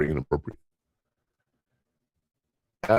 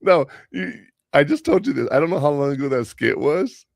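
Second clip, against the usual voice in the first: high, loud and insistent; exasperated; 9 semitones higher, 3 dB louder than usual. Real, not acted. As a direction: push back, pitch raised. Exasperated raised pitch and volume.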